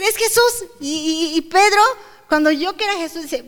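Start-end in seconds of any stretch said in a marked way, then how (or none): 0.1-0.7 higher, eager exclamation; excited recognition. Retold or imitated. Disciple telling the others it is Jesús, excited. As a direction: announce it with amazement.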